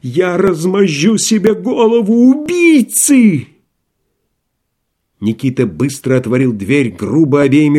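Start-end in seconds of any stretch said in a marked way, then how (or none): 0.0-3.5 исступлённый крик. Acted. Рагин в исступлении кричит.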